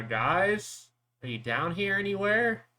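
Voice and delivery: loud, rising call into the ship. Acted. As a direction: call out for missing friends, pitch climbing. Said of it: "will just start calling out for them".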